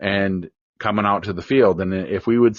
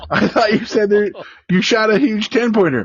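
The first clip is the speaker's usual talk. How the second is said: higher, amused disbelief; incredulous dad; 11 semitones higher than usual. Retold or imitated. Dad to son over the small buck, amused disbelief. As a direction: say it like a dad who can't believe what he sees.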